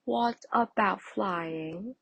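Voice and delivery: lightly voiced friendly bird; friendly robin. A narrator's reading. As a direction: ask it kindly, drawing it out. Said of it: "asked a friendly robin".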